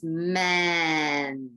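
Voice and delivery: 'ment' held long on the n; isolated syllable sound. Shown as a demonstration. Teacher sounding out the last syllable, slowly stretched. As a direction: draw out 'ment' slowly, then finish the t. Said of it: "what's the last syllable sound you hear?".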